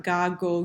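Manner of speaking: Ga, go with a hard g as in go. Shown as a demonstration. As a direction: hit a hard g in Ga and go. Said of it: "It's gonna make a hard G sound".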